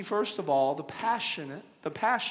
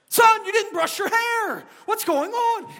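much higher frazzled mom shout; yelling across the room; 4 dB louder than usual. Acted. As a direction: shout at the kid across the house.

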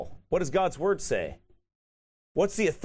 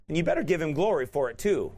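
usual speaking voice

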